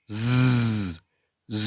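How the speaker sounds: sustained buzzing "zzz"; vibrating Z sound. Shown as a demonstration. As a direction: hold a voiced z with steady friction. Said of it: "এরকম".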